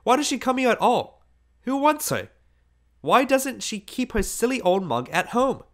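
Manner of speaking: very high, loud, exasperated questions; scornful complaint. A narrator's reading. Boy mocking the old woman to his girl, scornful complaint. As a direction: complain in a high-pitched, contemptuous rush of questions.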